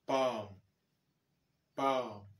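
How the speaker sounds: "palm" said with a long open a and a silent l, like pah-m; teacher modelling "palm" with a long ah and a silent l; English palm. Shown as a demonstration. A teacher models "palm" for French students, a long ah with a silent l. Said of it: "comme dans".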